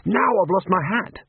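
a fretful wizard moaning; a hint of the panicked wizard; Rincewind. Rincewind dismayed.

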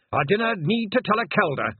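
high, loud Scottish brogue; proud Scottish elder. Acted. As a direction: refuse her as a proud old Scot.

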